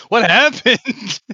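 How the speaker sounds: mock puzzled question, laughing; bewildered. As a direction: act baffled through a laugh. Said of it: "and you're like".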